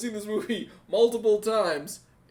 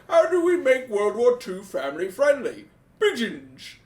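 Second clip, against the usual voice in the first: producer pitch; 5 semitones higher, 5 dB louder, slower than usual. Retold or imitated. Voices the Hollywood producer.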